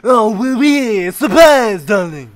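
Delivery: bad French accent. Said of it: "Are you that bad at French accents".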